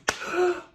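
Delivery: high sudden intake of breath; sharp excited gasp. Real, not acted. Fan gasping at the cameo reveal, sharp excited gasp. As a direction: gasp in delight.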